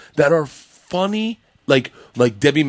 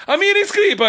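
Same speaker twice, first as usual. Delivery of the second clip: high, excitable Italian husband impression; hammed-up Italian-accented storyteller. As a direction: do a comic Italian accent, pitched well up. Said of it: "Her husband's an Italian guy".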